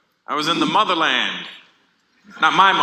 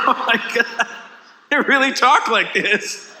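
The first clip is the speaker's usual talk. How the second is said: higher, slow, half-laughing disbelief; amazed laughing aside; 6 semitones higher than usual. Retold or imitated. Comic in the elevator, laughing to himself in amazement. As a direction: marvel through a chuckle. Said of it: "laughing to myself, going".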